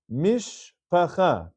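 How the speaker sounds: "Mishpacha" very slowly, broken as mish, pa-cha; shva nach reading. Shown as a demonstration. Teacher reading example number three, slow syllable-by-syllable demonstration. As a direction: say "Mishpacha" slowly with no vowel after the sh. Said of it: "so that's a shiva nach".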